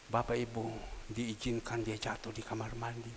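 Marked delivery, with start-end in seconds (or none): none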